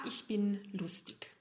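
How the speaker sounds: soft confiding whisper. Real, not acted. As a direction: let them in on a secret. Confides she is funny. (geflüstert)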